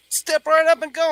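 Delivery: high, loud carnival-barker urging; mock hype-man shout; teasing friend. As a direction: egg him on like a showman.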